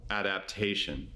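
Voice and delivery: low, measured yoga nidra voice with long pauses; guided meditation. Acted. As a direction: guide them gently into rest, low-pitched and unhurried.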